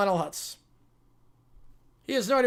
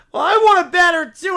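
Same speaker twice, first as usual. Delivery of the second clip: loud, higher whiny demand; demanding shout; 6 semitones higher, 11 dB louder than usual. Acted. Kid demanding the biggest banner, shouting.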